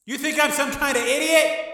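very high, loud outburst; furious shout. Acted. Gangster rejects agent's offer, furious shout. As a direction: yell it in disbelieving rage. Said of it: "Tommy shouted".

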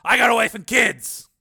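loud, high-pitched objection; indignant protest. Acted. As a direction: push back loudly at the insult. Steele protests at sergeant's insults.

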